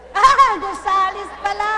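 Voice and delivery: high, loud "oh, it's Gonzales!"; loud mock surprise. Real, not acted. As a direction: act out the realisation big. (malakas na kunwaring gulat)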